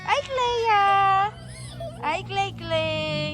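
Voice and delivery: high falling call. Real, not acted. Calling the dog Clea over, a high falling call. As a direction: sing her name out high.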